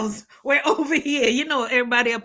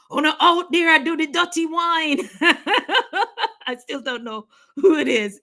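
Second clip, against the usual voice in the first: usual speaking voice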